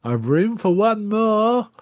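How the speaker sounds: lightly hint at a creepy undertaker; sinister undertaker. Retold or imitated. Undertaker calls up to the man, slow and lightly sinister. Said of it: "look up at our man and just say".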